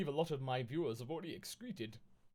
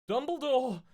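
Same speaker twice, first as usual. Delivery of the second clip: higher, louder call for help; yelling; 11 semitones higher, 8 dB louder than usual. Acted. Two girls calling Dumbledore while running upstairs, yelling. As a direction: shout his name up the stairs. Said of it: "we both yelled".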